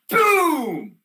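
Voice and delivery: high, loud drawn-out boom; shouted crash noise. Acted. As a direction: blast out the impact. Imitates truck crashing over curb.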